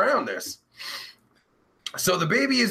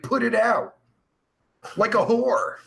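ordinary talk, nothing marked

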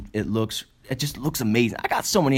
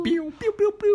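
very high vocal laser shots; pew-pew sounds. Pretend. Co-host answering with laser noises, high pew-pews. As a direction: join in with squeaky zaps.

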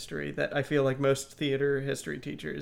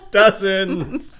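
laughing; 6 semitones higher, 10 dB louder than usual. Real, not acted. Teasing friend, laughing.

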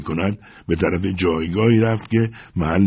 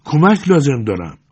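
high, raised desperate cry; loud, higher-pitched appeal; cry for help; 8 semitones higher, 5 dB louder than usual. A narrator's reading. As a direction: shout for help through a wall. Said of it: "فریاد زد".

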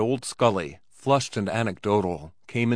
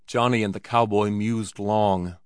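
plain reading voice

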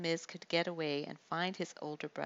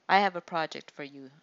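sneering; 9 dB louder than usual. A narrator's reading. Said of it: "Itamar sneered".

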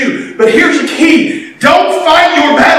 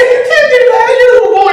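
much higher mock king objecting; doubting king; 12 semitones higher, much faster than usual. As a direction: scoff at the little boy. Saul objecting to David.

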